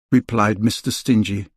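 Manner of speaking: ordinary delivery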